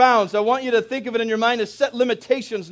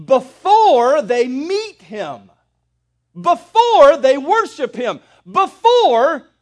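"before" stretched slow and rising louder each time; stress on before; 3 semitones higher, 5 dB louder, much slower than usual. Shown as a demonstration. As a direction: hammer each "before" harder.

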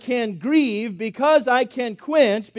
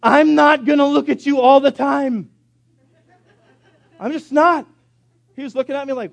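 louder, higher exasperated scolding; frustrated dad; 6 semitones higher, 6 dB louder than usual. Retold or imitated. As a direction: lose patience with your kid. Father frustrated with son.

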